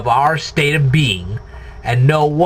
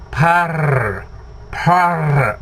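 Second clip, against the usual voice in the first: purring kitten. Shown as a demonstration. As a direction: purr the r like a kitten. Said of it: "purr like a kitten".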